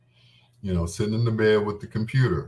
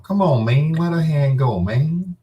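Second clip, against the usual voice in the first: higher, louder put-on Lucius voice; Lucius impression; 7 semitones higher, 6 dB louder than usual. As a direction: play Lucius begging him to drop her hand. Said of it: "I turned into Lucius on him".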